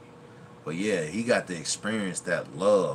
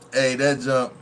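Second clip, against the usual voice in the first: normal talking voice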